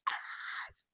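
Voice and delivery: voiceless, hushed imitation of screaming; mock scream. Fan retelling the big entrance, stifled mock scream. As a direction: scream it under your breath. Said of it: "I was probably screaming".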